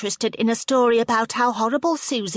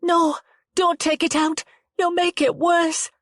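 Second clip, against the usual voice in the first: panicked plea; 10 semitones higher, 4 dB louder than usual. Acted. Rianne panics over the knife.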